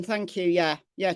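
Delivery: ordinary talk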